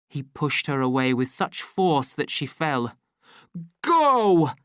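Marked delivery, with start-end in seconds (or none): 3.8-4.5 loud, higher furious shout. A narrator's reading. The savage standing over Lenina, furious shout. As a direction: bark the order at her. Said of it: "he shouted".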